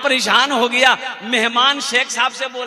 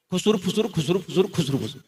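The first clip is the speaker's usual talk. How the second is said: softer and softer murmured gossip noise; mimicked gossip murmur; 4 semitones lower, 6 dB quieter, faster than usual. Mocking a young man gossiping among the women, fading murmur. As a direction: fade the gossip chatter down to nothing.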